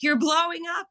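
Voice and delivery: high, excited squeal of a quote, playfully imitated; excited teen messages. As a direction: mimic excited girls, high-pitched and rising. Voicing girls' excited messages.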